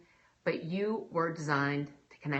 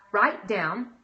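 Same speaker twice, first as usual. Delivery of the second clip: usual speaking voice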